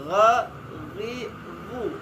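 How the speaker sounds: throaty gh on "Gha", then "ri, ru"; ghin letter demo. Shown as a demonstration. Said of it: "ghin sama huruf kha". (huruf ghin diucapkan dari pangkal tenggorokan)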